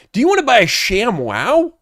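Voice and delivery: high, loud salesman pitch; infomercial pitch. Pretend. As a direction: sell it like a TV ad. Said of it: "please try a call like that".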